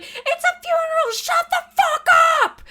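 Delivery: high, slow, furious scream; angry yell. Retold or imitated. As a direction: bellow it at the honkers. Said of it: "I'm like".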